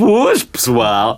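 slow, drawn-out youtuber greeting; Feromonas impression. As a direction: stretch out the greeting lazily. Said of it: "Anda lá, anda lá".

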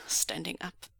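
ordinary delivery

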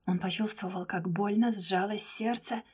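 ordinary delivery, nothing marked

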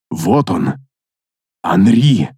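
low, cold and controlled fury; icy rage held in; cold anger. A narrator's reading. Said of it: "Голос паладина наполнился холодным гневом".

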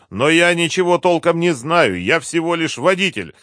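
high, loud, pleading excuses; cornered captive. A narrator's reading. Driver disclaims responsibility to interrogators, pleading. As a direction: plead that you only followed orders.

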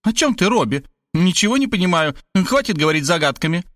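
high, exasperated questioning; mildly cross high mother voice; angry mother. A narrator's reading. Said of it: "Она рассердилась".

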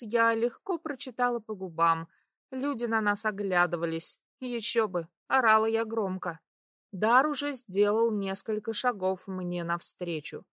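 ordinary delivery, nothing marked

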